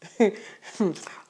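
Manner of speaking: a burst of real laughter, loud and high. Real, not acted. Preacher laughing at how absurd the excuse is. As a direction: laugh out loud, can't hold it in. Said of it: "I, I'm laughing".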